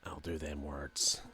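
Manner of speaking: low, slow, mock super mutant. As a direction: swallow the microphone and drawl it. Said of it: "the super mutant voice".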